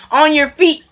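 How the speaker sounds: lightly acted demand to an ignoring prisoner; insistent mock command. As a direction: push the order up in pitch. Officer repeats ignored order, retold.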